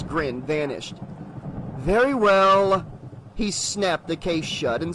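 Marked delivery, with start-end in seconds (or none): 1.8-2.8 cold salesman. Acted. Salesman drops his act.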